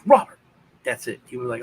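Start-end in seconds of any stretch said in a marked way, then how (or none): 0.1-0.4 a sharp, high, loud call of the name; calling out his name. Retold or imitated. Mother spotting her hiding son calls his name sharply. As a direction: snap his name out the moment you spot him.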